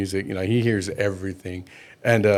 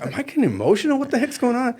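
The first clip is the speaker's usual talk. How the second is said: pitched up, half-laughing disbelief; higher, incredulous self-question; mock bewildered; 13 semitones higher than usual. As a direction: play the kid caught off guard by his own tears. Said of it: "I'm like".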